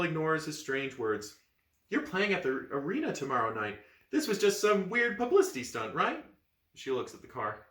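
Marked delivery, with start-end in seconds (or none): 1.9-6.2 excited. Acted. Excited girl.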